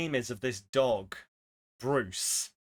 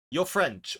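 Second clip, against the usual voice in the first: ordinary delivery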